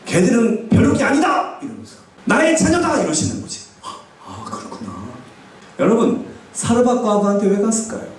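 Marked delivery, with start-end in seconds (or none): 0.1-1.6 high, emphatic divine correction; God's rebuke. Acted. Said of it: "하나님이 나를 쾅 때리는 거야". 2.2-3.0 high, ringing divine declaration; God's rebuke. Acted. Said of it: "하나님이 나를 쾅 때리는 거야". 3.8-4.9 very soft, low, humbled response; hushed realization. Acted. Humbled reply to God's rebuke.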